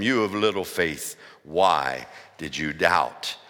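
Jesus gently chiding Peter; gentle Jesus. Acted.

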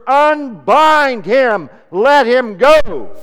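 very loud, high and very slow; thundering command. Acted. Jesus commanding the grave clothes off, thundering slow shout. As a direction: hurl the command out over the crowd. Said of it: "Jesus spoke the words".

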